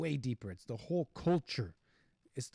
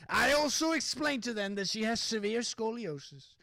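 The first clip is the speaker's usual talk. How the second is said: higher-pitched, overdone bad British accent; botched British accent; 7 semitones higher than usual. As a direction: attempt a British woman's accent and miss. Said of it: "I can't do the accent".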